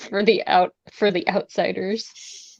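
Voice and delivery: normal talking voice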